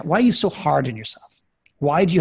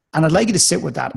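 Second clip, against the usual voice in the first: ordinary delivery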